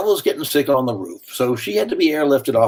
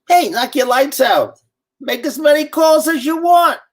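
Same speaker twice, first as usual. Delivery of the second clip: put-on loud New Orleans accent; 13 semitones higher, 4 dB louder than usual. Gambino telling the host to make all the calls he wants, loud New Orleans accent. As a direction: boom it out like a generous New Orleans wise guy. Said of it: "in a very raspy New Orleans accent".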